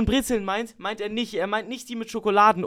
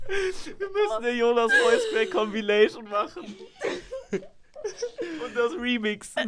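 crying with laughter; words squeezed out laughing; slower than usual. Real, not acted.

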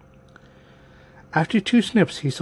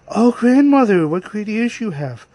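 put-on high little-girl exclamation, starting loud; girlish amazement; 6 semitones higher, 4 dB louder than usual. A narrator's reading. Girl staring at disguised grandmother, high amazed voice. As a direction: marvel at it in a high young voice.

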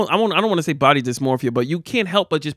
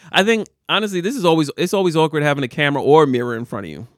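normal talking voice

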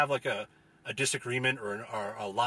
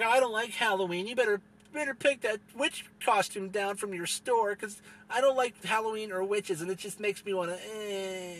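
higher, whiny put-on voice of a grumpy complainer; mocking holiday hater; 7 semitones higher than usual. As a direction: parody the killjoy with a sneering whine.

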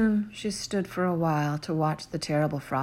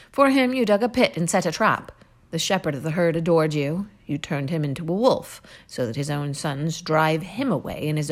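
louder, scornful list of her victims; accusing hero; 3 dB louder than usual. Acted. Gilgamesh accuses Ishtar of her past loves, louder and scornful. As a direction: throw her cruelty back at her.

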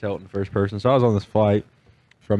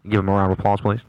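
pilot announcement; 4 dB louder than usual. Retold or imitated. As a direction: welcome the passengers like a pilot on the intercom. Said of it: "it was kinda like that".

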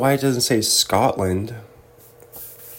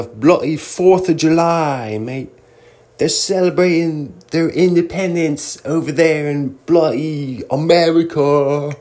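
mock British accent; 5 semitones higher than usual. An imagined Irish local corrects the tour site, in a mock British accent. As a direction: toss off a bloody and a mate like a Brit. Tour dates read as Brit.

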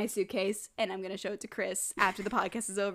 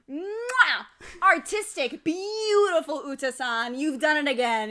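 gushing praise; 3 semitones higher, 4 dB louder than usual. Real, not acted. Chef's kiss to mask maker.

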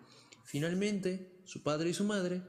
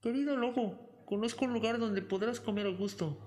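called-out offer; 7 semitones higher than usual. A narrator's reading. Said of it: "gritó desde su interior".

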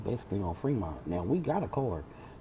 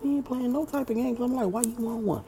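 pitched way up, sassy and offended; high indignant denial; 13 semitones higher, 4 dB louder than usual. Retold or imitated. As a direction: snap back, high and defensive. Defensive reply to boyfriend.

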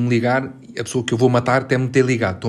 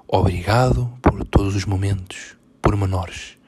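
slow, louder, mock-solemn recital dropping into a breathy whisper; mock poem recital; 3 semitones lower, 3 dB louder, slower than usual. Host mocks an ex's love post as a poem, hammed-up. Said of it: "como se isto fosse um poema".

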